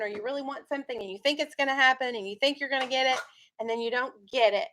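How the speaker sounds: ordinary talk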